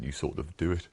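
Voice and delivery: ordinary talk, nothing marked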